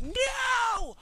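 very high, drawn-out wail of protest; hammed-up shriek at someone who left; mock outraged scream. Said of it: "and you're like".

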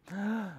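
sharp gasp. Acted. Spotting the tiger.

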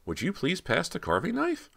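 high, stagy, smiling delivery; hammed-up actor line. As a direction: overplay the happy dad at dinner. Bert acting the dad role.

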